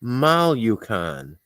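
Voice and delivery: "Malucan" very slowly, syllable by syllable; checking the pronunciation with the chat; sounding it out. Real, not acted. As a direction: spell "Malucan" out with your voice. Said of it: "Did I say that right, Shay?".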